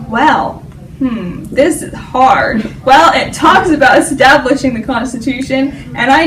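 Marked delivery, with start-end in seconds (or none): none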